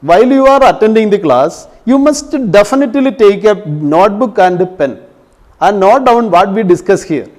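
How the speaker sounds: very slow, every word spaced for writing down; slow dictation. Shown as a demonstration. Teacher dictating a note to students, slow and spaced. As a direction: dictate it slowly so they can copy. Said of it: "ഇതൊക്കെ not ചെയ്ത് വെക്കണം".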